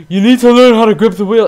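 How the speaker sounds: imitating dad's loud, high scolding; exasperated mock-dad yelling; shouted lecture. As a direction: scold loudly like a fed-up teacher. Dad lecturing in retold quarrel.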